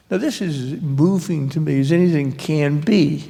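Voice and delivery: ordinary delivery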